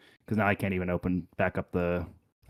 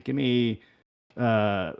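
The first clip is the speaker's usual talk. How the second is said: usual speaking voice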